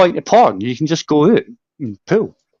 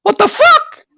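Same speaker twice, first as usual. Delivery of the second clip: starts loud and climbs ever higher into a shrieking fake tantrum; mock rage building to a shriek; 12 semitones higher, 5 dB louder than usual. Teasing Uncle JJ for letting everyone hear, a mock rage building to a shriek. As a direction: pretend fury that spirals up to a squeal.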